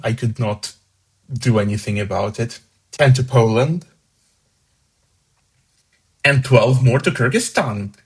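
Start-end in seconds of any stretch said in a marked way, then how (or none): none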